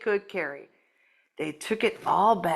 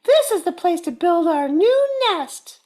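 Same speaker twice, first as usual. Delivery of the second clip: high, louder bird voice; Missus Bird; 9 semitones higher, 6 dB louder than usual. Acted. Missus Bird decides on the nest, high and certain. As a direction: chirp it out high and sure. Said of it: "said Missus Bird".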